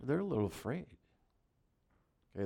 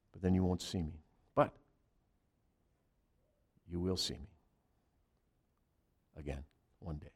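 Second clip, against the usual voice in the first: much softer, gentle reassuring voice of Jesus; tender Jesus; 6 semitones lower, 10 dB quieter than usual. Acted. Jesus reassuring his fearful disciples, softly tender. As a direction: speak low and tender, comforting frightened friends.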